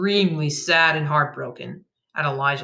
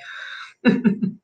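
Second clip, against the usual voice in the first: villain's chuckle; 4 semitones higher than usual. A narrator's reading. Bandit gloats over fallen victim.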